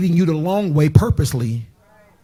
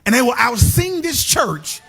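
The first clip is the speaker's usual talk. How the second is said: high, slow preacher's whoop; fiery sermon climax; preaching at full force; 5 semitones higher, slower than usual. Acted. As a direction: drag the words out and call them home.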